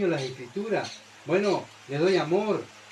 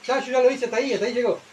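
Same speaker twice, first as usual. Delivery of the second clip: higher and louder, smug shrug of a lazy believer; mock smug excuse; 6 semitones higher, 3 dB louder, much faster than usual. Preacher mimicking a believer who stopped halfway, smug. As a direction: toss it off like it's done.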